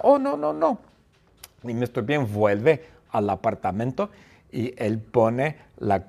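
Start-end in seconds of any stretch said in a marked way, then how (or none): 0.0-0.9 alarmed cry. Acted. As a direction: squeal in sudden alarm. Mr Bean remembers teddy. (grito agudo de alarma)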